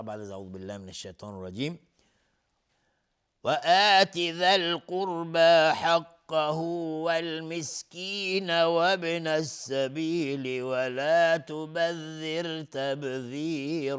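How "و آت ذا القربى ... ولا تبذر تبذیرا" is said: slow, drawn-out melodic Quran chant; Quran recitation. Acted. Teacher recites the verse for viewers, slow melodic chant. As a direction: recite each word slowly and melodically, like tilawa.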